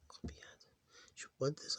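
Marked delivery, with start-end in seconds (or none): 0.1-0.6 whispered word. Shown as a demonstration. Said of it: "whole word whispered".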